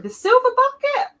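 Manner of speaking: SILVER BUCKET in a very high, loud squeal; high, loud cheer on "The silver bucket!"; excited exclamation. Real, not acted. Exclaims the same words excitedly.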